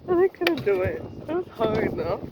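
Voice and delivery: laughing at own failure. Real, not acted. Laughing admission.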